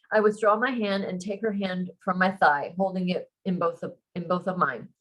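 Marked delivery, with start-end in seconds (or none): none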